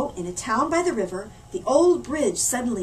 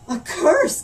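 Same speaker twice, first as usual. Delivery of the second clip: louder, higher gasped question; mayor gasping in alarm; 6 semitones higher, 8 dB louder than usual. Acted. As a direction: gasp in alarm. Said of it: "gasped the mayor".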